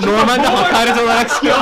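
high, loud, through laughter; laughing confession. Real, not acted. Friend confessing the name was meant as insult, laughing. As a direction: barely hold back the laughter while admitting it. Admitting the insult while cracking up.

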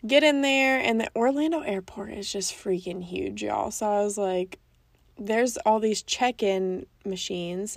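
ordinary delivery

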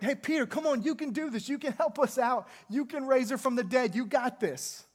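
imitating widows egging Peter on; eager urging. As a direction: hype him up like a desperate crowd.

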